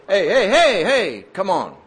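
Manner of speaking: loud, high repeated heys; calling a halt. Retold or imitated. God interrupts a boasting believer, loud checking call. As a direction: cut in loudly to pull someone up short.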